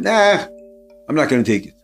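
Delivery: usual speaking voice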